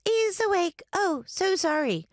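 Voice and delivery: put-on high-pitched little-girl voice; child ghost apologizing. A narrator's reading. Child ghost apologizing to her guests, high and childlike.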